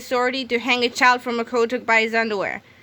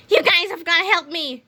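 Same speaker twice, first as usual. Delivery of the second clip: panicked plea; 7 semitones higher than usual. A narrator's reading. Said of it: "he cried".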